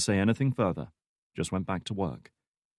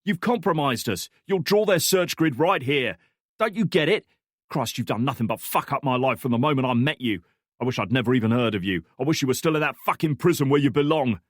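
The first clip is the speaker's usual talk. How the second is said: higher, louder furious tirade; raging; 5 semitones higher, 5 dB louder than usual. Acted. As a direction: tear into her with fury. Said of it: "he raged".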